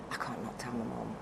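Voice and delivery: breathy whisper, voice dropped away; whispered thought. Retold or imitated. Recalling agonising over telling her mum, whispered. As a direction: whisper it like a secret you can't keep.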